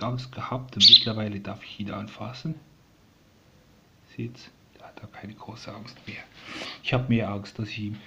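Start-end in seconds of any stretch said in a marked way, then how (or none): 4.2-6.2 hushed whisper to viewers; quiet aside whisper. Real, not acted. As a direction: whisper so the bird isn't startled. Whispering so bird stays calm.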